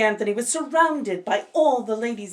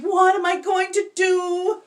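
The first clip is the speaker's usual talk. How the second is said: high, louder panicked question; cried out; 10 semitones higher, 6 dB louder than usual. Acted. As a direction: ask in panic, much higher. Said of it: "he cried".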